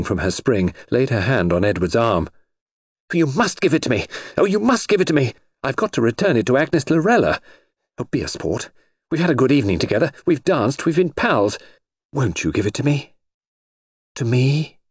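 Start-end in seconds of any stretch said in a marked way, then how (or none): 3.1-7.4 high-pitched, insistent begging; urgent pleading. Acted. Noreen begs for the necklace back. 8.0-14.8 wheedling, pitched-up appeal to a pal; coaxing plea. Acted. Noreen coaxes Edward for the necklace.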